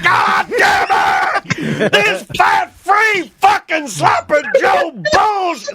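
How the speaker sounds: slow, drawn-out hammed-up shout; mock furious rant. Son voicing dad raging at fat-free Sloppy Joe, hammed-up shout. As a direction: bellow it like dad over fat-free food. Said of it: "I'd be like".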